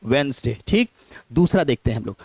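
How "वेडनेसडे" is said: वेडनेसडे said "wens-day", D silent; correct pronunciation. Shown as a demonstration. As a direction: squeeze वेडनेसडे into two syllables, wens-day. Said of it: "सही word".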